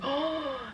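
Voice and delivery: imitated breathy gasp, soft and high; shocked gasp. Wife watching the birth suddenly, imitated shocked gasp. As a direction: gasp as if startled by the sight.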